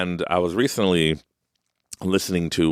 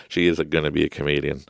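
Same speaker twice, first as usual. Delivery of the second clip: normal talking voice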